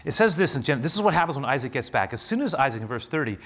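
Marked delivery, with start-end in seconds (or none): none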